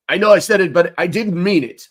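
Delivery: mock president pleading he didn't mean it; mocking Macron. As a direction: play a flustered leader backpedalling.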